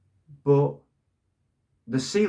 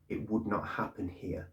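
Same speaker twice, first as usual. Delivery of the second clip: quiet conviction; 8 dB quieter than usual. Retold or imitated. Vlogger quoting Ferguson to viewers, soft slow certainty. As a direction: say it quietly like a settled fact. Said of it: "he said it with absolute conviction".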